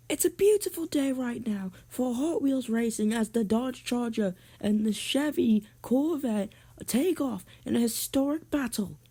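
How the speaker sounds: high-pitched put-on race announcer; sportscaster voice. Sportscaster opening the final race, high announcer voice.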